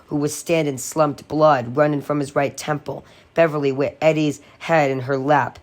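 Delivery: usual speaking voice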